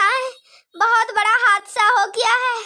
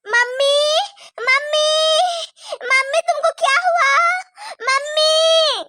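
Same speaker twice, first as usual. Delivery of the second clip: put-on high tearful child calling mummy; daughter's wail over her mother; crying child; 5 semitones higher than usual. Daughter crying over her unconscious mother, high and tearful.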